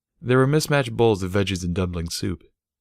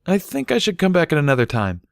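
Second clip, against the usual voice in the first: a touch of nerves, higher voice; nervous; 10 semitones higher, 3 dB louder than usual. A narrator's reading. Young colt nervously excusing himself to the family. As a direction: repeat it nervously, wanting out. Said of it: "he repeated nervously".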